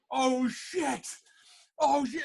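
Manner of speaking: high-pitched mock dread; panicked realisation. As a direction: replay a sudden "uh-oh" panic. Acts panic when call is answered.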